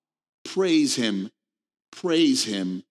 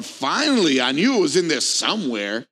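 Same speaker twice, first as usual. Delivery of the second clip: sarcastic; 3 semitones higher, 3 dB louder than usual. Acted. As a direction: act out a sarcastic "at last" jab. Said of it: "the sarcasm that will make that person".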